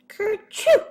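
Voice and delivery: big high sneeze; a sudden sneeze from inside the watering can; loud sneeze. A narrator's reading. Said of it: "Then he sneezed".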